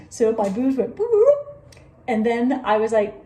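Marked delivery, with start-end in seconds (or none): 1.0-1.8 high, loud cartoon boops; playful sound-effect boops; imitated popping noise. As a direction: pop each boop up high.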